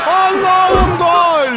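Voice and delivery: long slow drawn-out goal cry; screaming football commentator. Acted. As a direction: stretch the goal call out slowly.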